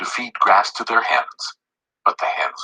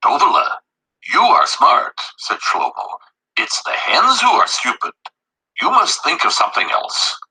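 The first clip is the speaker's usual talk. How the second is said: higher, fond voice; lightly raised fond father; 8 semitones higher than usual. A narrator's reading. Read to children: father praises his daughter, lightly fond. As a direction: praise the child, blame the hens.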